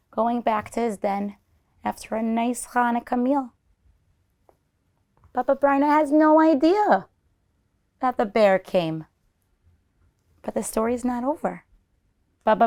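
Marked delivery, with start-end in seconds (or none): none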